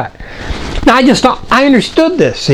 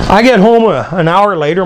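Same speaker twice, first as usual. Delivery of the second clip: normal talking voice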